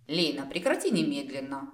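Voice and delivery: ordinary delivery, nothing marked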